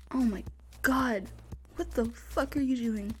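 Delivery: ordinary talk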